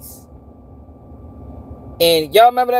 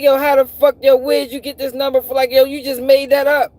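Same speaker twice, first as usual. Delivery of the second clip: high-pitched, disbelieving protest; incredulous challenge; 5 semitones higher than usual. Retold or imitated. Man challenging where the number came from, incredulous. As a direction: call out an obvious invention.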